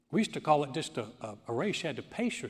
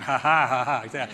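a big teasing laugh, louder than his talk; loud mocking laugh; 9 dB louder than usual. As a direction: laugh at her out loud, poking fun. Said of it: "And I'm like".

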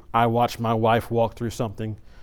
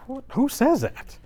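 much higher, retold outrage; mock-shocked at the rude remark; shocked disbelief; 12 semitones higher, slower than usual. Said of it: "And I'm like".

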